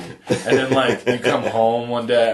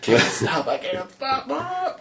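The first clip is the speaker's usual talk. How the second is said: panicked running; slower than usual. Pretend.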